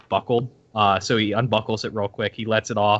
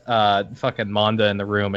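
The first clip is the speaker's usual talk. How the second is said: ordinary delivery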